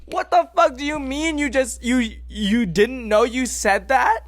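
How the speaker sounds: high, loud indignant challenge; worked-up retort, stretched out; incredulous outrage. Retold or imitated. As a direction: throw the question back in disbelief. Acted retort to the offender.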